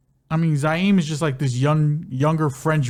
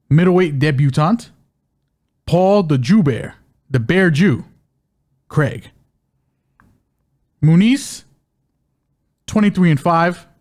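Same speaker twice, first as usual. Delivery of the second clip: ring announcer; 5 dB louder than usual. Acted. Podcast host introduces the fighters like a loud ring announcer. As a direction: boom out each name like a ring announcer.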